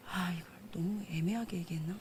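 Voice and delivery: soft breathy whisper to herself; whispered aside. Real, not acted. Preacher whispering to herself mid-sermon. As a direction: mutter the doubt under the breath.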